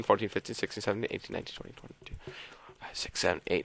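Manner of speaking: counting that fades into a whisper; fading count. Real, not acted. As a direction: let the count drop to a whisper. Counting zeros, fading away.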